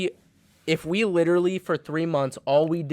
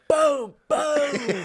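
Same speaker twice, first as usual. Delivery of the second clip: high, booming mock pistol shots; imitated gunshots; 11 semitones higher than usual. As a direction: fire off two pretend shots with a laugh. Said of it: "I pull out two flintlocks and I'm like".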